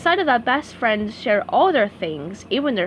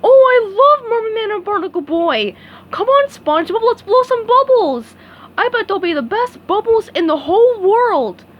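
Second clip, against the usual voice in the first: excited; 10 semitones higher, 7 dB louder than usual. A narrator's reading. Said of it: "jumped up and down with excitement".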